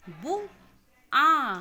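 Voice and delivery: पुल drawn out slowly and loud, each sound clear; slow syllabic reading. Shown as a demonstration. Teacher reads पुल slowly, loud and stretched. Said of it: "अक्षर को मात्रा के साथ आप पढ़ेंगे".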